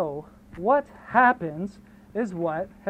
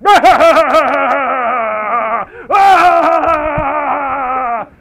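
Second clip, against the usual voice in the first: loud, high mocking cackle; villain laugh; 6 semitones higher, 12 dB louder than usual. The Prime Minister gloating at the trekkers, loud cackle.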